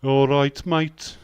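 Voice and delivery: loud Birmingham drawl; hammed-up Brummie impression; Brummie accent. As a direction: shout a mock Brummie greeting. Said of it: "Uh, Birmingham".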